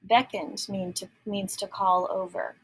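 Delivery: usual speaking voice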